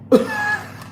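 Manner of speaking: a squeaky burst of laughter; high squeal laugh. Real, not acted. Laughing at her own anger with viewers, squealing giggle.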